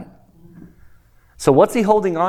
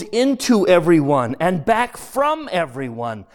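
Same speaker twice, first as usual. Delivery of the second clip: reading the novel aloud, voicing ghost and spirit; steady read-aloud for the study group; story narration. A narrator's reading.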